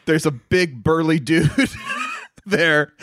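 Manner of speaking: real laugh-shout. Real, not acted. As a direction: burst out laughing at the punchline.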